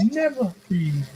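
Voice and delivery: put-on half-hearted African accent. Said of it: "Give me, give me a never freeze".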